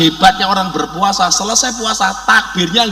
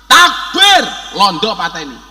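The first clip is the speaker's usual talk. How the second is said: rallying battle cry; 6 dB louder than usual. Acted. Cleric rousing independence fighters, rallying shout. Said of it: "Untuk membakar semangat para pejuang".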